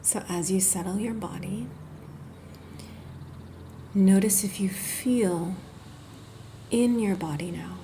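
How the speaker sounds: unhurried, gentle body-scan guidance; guided meditation. Acted. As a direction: ease the listener into rest, phrase by phrase. Leads a guided yoga nidra.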